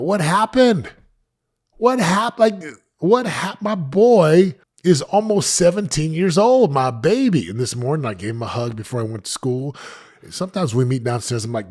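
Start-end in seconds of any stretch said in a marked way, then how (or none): 0.0-7.5 high, loud cry of disbelief; a father gasping that his baby grew up; stunned disbelief. Retold or imitated. Father marvelling at his son nearly seventeen, high and loud disbelief.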